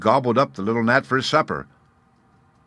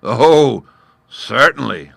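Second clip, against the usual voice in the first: laughing; 4 semitones higher, 9 dB louder, slower than usual. A narrator's reading. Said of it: "laughed the lion".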